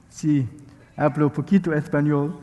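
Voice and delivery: lisped Castilian-style Spanish; Spanish accent. Shown as a demonstration. Preacher showing off a little Spanish to the congregation, Spanish accent. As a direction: switch into deliberate Spanish pronunciation. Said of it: "in Spanish".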